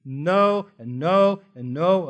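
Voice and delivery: each no punched firmly; emphatic repeated no. Acted. Preacher denying that God is unjust, punching each no. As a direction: hammer the denial home. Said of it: "a thousand times no".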